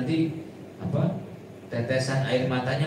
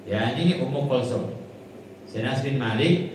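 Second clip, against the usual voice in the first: ordinary talk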